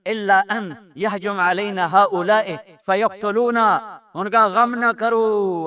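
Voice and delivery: ordinary delivery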